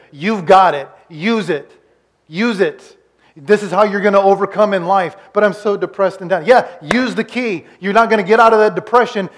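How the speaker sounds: loud, high, driving exhortation; fired-up preaching. Acted. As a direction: urge the congregation on at full force. Preaches at full force.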